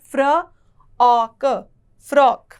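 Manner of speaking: फ्र, औ, क, then a loud फ्रॉक; frock split then joined. Shown as a demonstration. Teacher models फ्रॉक for students, loud. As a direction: build फ्रॉक from parts, then say it whole and loud. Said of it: "फ के साथ र का उच्चारण".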